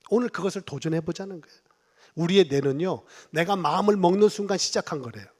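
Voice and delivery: normal talking voice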